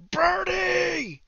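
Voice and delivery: high, loud scream of a name. Acted. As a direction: scream it out in sudden alarm, drawn out. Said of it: "He begins screaming".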